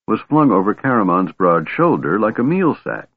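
ordinary delivery

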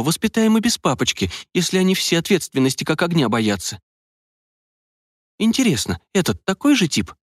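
put-on a young woman thinking aloud, wry. A narrator's reading.

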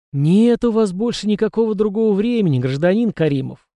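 high, emphatic gloating through a wide smirk; gloating relish. A narrator's reading. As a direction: savour the refusal with obvious pleasure. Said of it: "с явным удовольствием выговорил".

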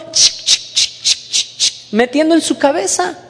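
sharp voiceless repeated "chic"; rhythmic click sounds, like stuffing something in; imitated clicking noise. Imitates something being put in. (chasquidos imitados, sin voz)

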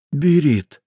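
high frantic call of a name; frantic shout. Acted. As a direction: call after her in panic. Ingri calls fleeing sergeant. (отчаянный крик)

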